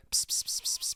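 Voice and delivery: soft whispered "pss pss" lure; quick hushed hisses to coax a cat; kissy cat-calling noise. Retold or imitated. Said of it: "like, the little kissy noise".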